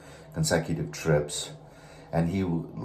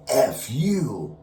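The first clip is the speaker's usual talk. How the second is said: higher, louder mock angry retort; angsty curse; 8 semitones higher, 4 dB louder than usual. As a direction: throw it out with extra angst. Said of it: "with a little bit of angst, a little extra".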